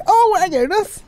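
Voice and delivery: very high, airy mock line. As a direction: play it coy and harmless. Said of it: "to be like".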